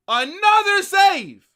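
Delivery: loud, high, drawn-out disbelieving yell; exasperated shout. Real, not acted. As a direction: belt it out as another shot is saved. Keeper saves again, yelling.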